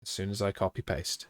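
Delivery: usual speaking voice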